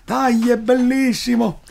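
high, slow, loud gushing; excited. Retold or imitated. Enthusiastic quote.

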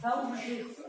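Wałbrzychu with the weight on BRZY; Wałbrzychu, penultimate syllable BRZY leaned on; stress on the second-to-last syllable. Shown as a demonstration. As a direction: model Wałbrzychu clearly as Wał-BRZY-chu, stressing the middle syllable. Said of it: "pan akcentuje jak gdyby pierwszą sylabę".

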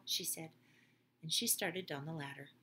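ordinary delivery, nothing marked

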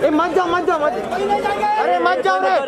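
real high, urgent calling out; urgent shouting. Real, not acted. Crowd member telling others not to go, urgent shouting.

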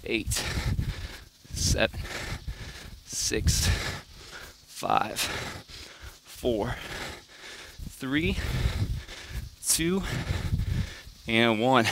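quiet, strained counting mid push-up; counting workout reps. Real, not acted. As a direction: count reps under your breath while working. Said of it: "I'm gonna count it out for you too".

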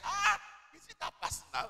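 high, loud exclamation; retold indignant surprise. Preacher re-enacting their shock at a visa refusal, high and indignant. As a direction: protest in high-pitched disbelief.

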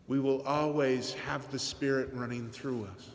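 ordinary talk

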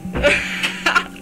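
breathy, voiceless burst of laughter; laughing fit. Real, not acted. Gamer cracks up during an interruption, voiceless laughing fit. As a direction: laugh until the voice gives out. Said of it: "Entschuldigung, Leute, für diese kleine Unterbrechung".

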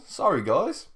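ordinary talk, nothing marked

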